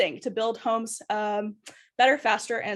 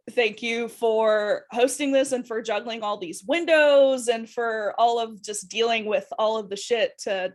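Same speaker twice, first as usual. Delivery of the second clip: ordinary talk, nothing marked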